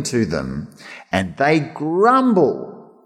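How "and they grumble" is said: slow, weighted "grumble"; slow, disapproving "And they grumble"; drawn out. Real, not acted. Slow emphatic refrain.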